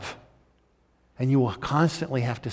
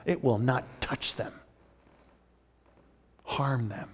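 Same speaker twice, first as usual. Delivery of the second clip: usual speaking voice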